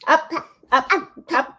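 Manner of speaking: high singsong play words. Real, not acted. Playing with the baby.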